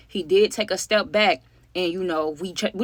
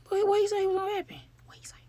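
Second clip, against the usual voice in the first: high, breathless whisper asking what he said; tense whisper; 13 semitones higher, 5 dB quieter, faster than usual. Retold or imitated. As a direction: whisper it so the gunman won't hear. Group waiting, nervous aside.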